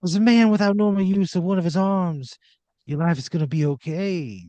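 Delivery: breathy talk-down. Acted. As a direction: purr it like a glam rock star. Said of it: "he goes".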